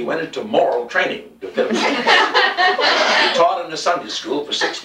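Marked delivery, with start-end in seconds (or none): 2.0-3.2 high, bright laugh breaking out; burst of laughter. Real, not acted. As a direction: laugh out loud at the joke. Reader laughs at own story.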